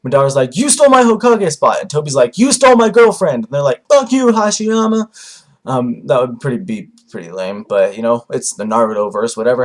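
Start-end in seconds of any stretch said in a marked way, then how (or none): 0.6-1.8 mock outrage. Madara accusing in mock quarrel. 2.4-3.4 mock outrage. Toby accusing in mock quarrel. 3.9-5.1 mock curse. Both cursing the first Hokage.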